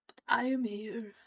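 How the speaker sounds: playful mock-dramatic whisper. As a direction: whisper it like a trapped princess. Playful aside about never travelling.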